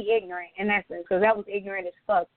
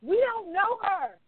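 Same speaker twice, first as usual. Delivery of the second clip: high, insistent indignant retort; snapping, mad as hell; 8 semitones higher than usual. Retold or imitated. Retelling how she snapped at the server's assumption, pissed off and insistent. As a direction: pitch it up and stretch the denial out. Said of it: "I, I just started snapping".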